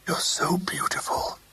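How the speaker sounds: breathless, husky whisper to his wife. A narrator's reading. Husband whispers to Rosemary, husky whisper. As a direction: breathe it, barely voiced. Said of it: "he said huskily".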